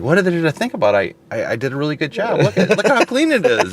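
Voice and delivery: high-pitched, incredulous retold pleading; exasperated protest. Window cleaner arguing for his pay, retold exasperated protest. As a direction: plead your case, voice climbing.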